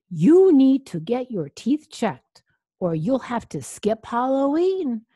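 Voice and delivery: high, loud storybook butler; butler's warning. A narrator's reading. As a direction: lay down the warning forcefully.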